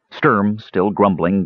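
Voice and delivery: usual speaking voice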